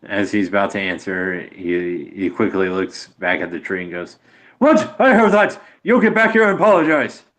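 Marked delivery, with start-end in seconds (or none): 4.6-7.2 high, loud indignant shout; furious hollering at someone getting away; outraged yelling. Acted. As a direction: yell back in outrage, pitch shot way up. Figure yells back at the tree.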